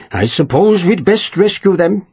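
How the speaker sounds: exaggerated Welsh accent. A narrator's reading. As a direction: lay on the Welsh with scorn. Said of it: "spoken in an exaggerated Welsh accent".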